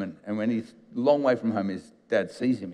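ordinary talk, nothing marked

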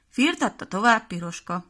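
ordinary delivery

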